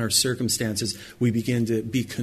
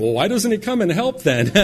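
higher-pitched questioning objection; mock protest, pitched up; doubting listener; 8 semitones higher, 4 dB louder than usual. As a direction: voice the listener's puzzled challenge. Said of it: "I know what you're thinking".

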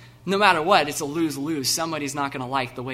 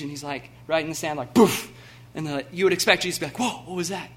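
loud, fired-up preaching that builds to a peak; preaching at full force; 3 semitones higher, 9 dB louder than usual. Acted. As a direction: preach it with everything you have, to the back row.

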